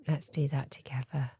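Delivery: hushed, mock-prayerful whisper; very soft unvoiced murmur. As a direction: whisper it softly.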